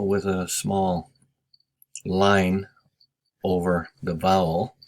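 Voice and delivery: normal talking voice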